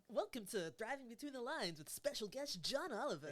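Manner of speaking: big, high, loud guest introduction; show announcer. Pretend. Host imagines introducing John Oliver on the show, playful announcer. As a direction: announce a dream guest with mock fanfare.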